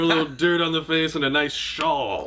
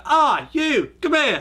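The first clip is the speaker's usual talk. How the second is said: mock bratty British kid, pitched way up; British kid with attitude; 12 semitones higher, 4 dB louder than usual. Seven-year-old threatening to beat someone up, high British-kid attitude. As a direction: imitate a cocky little British kid, high. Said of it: "shitty little British kid that's got, like, attitude".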